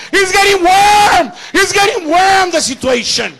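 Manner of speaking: high, loud shouted preaching; full-force preaching. Acted. Preacher to congregation, full-force shouted preaching.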